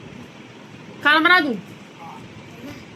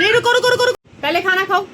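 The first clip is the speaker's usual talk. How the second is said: urgent stop; 6 semitones higher, 4 dB louder than usual. Real, not acted. Mother stopping her child from grabbing food, loud and urgent. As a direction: call out sharply, stop them right now.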